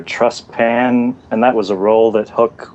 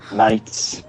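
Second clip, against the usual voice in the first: put-on mock accent on "Mates"; Emma's mock accent; 3 semitones lower, 4 dB quieter than usual. Shown as a demonstration. Podcaster asked to say mates like Emma, mock accent. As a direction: say "Mates" in a teasing imitation accent. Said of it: "Can you do the accent?".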